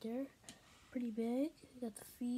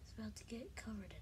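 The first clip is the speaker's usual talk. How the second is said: whispering; 8 dB quieter, faster than usual. Real, not acted. Repeats whisper while filming.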